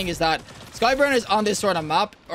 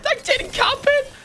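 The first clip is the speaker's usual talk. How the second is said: excited squeal; 12 semitones higher, much slower than usual. Real, not acted. Spawn camped, gets a kill.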